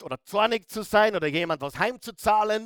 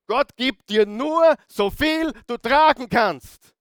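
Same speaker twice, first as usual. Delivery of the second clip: slow, raised preaching voice, higher and louder; loud emphatic repeat; 7 semitones higher, 8 dB louder, slower than usual. Real, not acted. Preacher repeating a popular saying to the congregation, slow loud emphasis. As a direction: proclaim each word forcefully.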